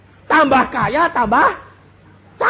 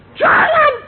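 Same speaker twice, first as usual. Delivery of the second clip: shout; 12 dB louder than usual. Retold or imitated. Child shouts in confusion.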